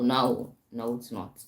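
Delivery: usual speaking voice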